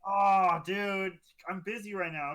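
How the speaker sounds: ordinary talk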